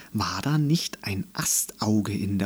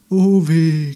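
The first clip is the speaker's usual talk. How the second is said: high, loud wail of woe; drawn-out woeful cry; lamenting; 6 semitones higher, 6 dB louder than usual. A narrator's reading. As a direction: moan it out like wailing trees. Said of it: "klagten sie".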